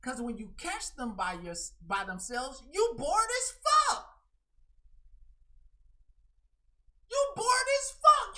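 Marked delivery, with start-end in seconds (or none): 2.7-8.3 high, loud exasperated cry; loud high scolding. Real, not acted. Streamer loudly mocking how boring the so-called friends are. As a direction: yell it in disbelief.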